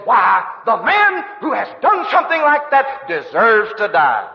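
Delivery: angry high, loud outburst; hot temper. Acted. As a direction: thunder it like an enraged king passing judgment. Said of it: "stirred that old red-headed temper of his".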